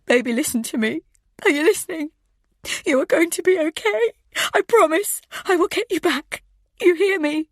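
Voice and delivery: high, loud, urgent reassurance holding back sobs; steady the boy while you're breaking; mother reassuring her frightened son over the phone; forced clear through tears. Acted. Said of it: "force the words out as clear as I can".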